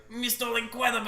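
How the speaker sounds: high, loud squeaky French villain cry; Bomb Voyage impression. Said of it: "Bon Voyage from The Incredibles".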